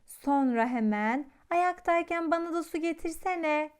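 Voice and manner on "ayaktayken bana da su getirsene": lazy little dinosaur calling out, pitched up; bossy call for someone to fetch water; calling out lazily. A narrator's reading. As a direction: call across the room for water without getting up. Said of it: "diye seslenirmiş".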